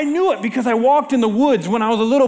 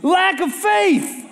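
Jesus rebuking; 8 semitones higher, much slower than usual. Acted. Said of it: "he yells at his disciples".

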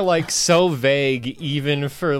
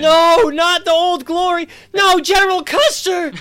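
mock wail; 9 dB louder than usual. Mock grief over Confederates.

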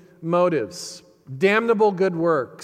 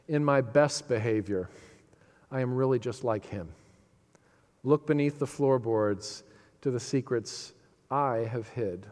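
hushed; 5 semitones lower, 5 dB quieter than usual. Acted. Hushed reading of song's last line.

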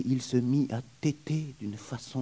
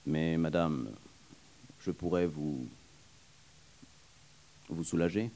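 lower, hesitant stammer; 6 semitones lower than usual. Acted. Troubled young man stammering to the nurse, lower. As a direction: falter shyly over the offer. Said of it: "troublé, balbutia".

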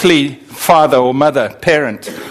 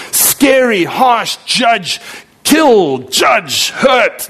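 mock harsh judge; 6 semitones higher, much slower than usual. Mocking the scary God.

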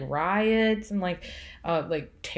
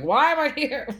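mock despair; 6 semitones higher, 4 dB louder than usual. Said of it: "I'm like".

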